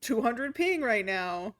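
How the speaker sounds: loud exasperated outburst; frustrated yelling. Real, not acted. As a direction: yell at the laggy game. Game lagging badly.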